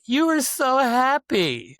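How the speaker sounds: high, slow put-on voice for his friend; hammed-up friend's excuse; imitating Karen. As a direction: voice her sweet excuse with a drawn-out lilt. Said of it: "And she was like".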